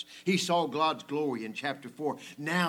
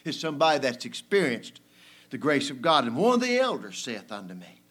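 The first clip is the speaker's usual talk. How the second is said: usual speaking voice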